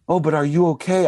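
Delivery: high, caring mother's voice, imitated; retold motherly concern in a high voice; concerned mom. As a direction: ask as a doting mom, pitched well up. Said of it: "She goes".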